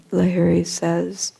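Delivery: ordinary talk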